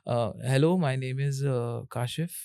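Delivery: quiet, halting "hello, my name is" with an "अ" before each part; unsure self-introduction that stumbles and hangs on fillers; hesitant intro. Shown as a demonstration. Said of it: "ये बिल्कुल अच्छा नहीं लग रहा है".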